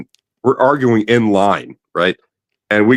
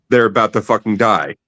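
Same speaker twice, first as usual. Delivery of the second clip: normal talking voice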